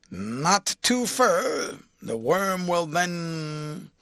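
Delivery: slow, drawn-out grumbling coachman; chafed temper. A narrator's reading. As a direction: let the driver's temper rise slowly. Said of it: "becoming more chaffed in temper".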